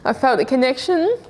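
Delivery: ordinary delivery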